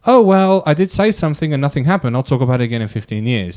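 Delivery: high, loud sarcastic impression of a passive victim; mock the helpless shrug, loud and high; mocking whiny victim. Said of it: "would you just be like".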